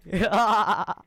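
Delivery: loud, high laugh; cracking up hard; burst of laughter. Real, not acted. Gamer laughing at the fallen opponent, loud burst.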